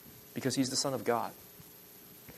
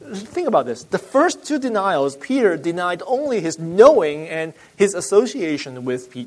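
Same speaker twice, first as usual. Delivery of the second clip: ordinary delivery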